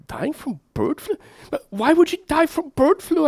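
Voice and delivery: a hint of a high, disbelieving question; mock surprise. Father asks his daughter, lightly mock astonished. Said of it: "I ask her".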